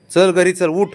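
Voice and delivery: loud high call to the pet. Real, not acted. Calling pet loudly to come.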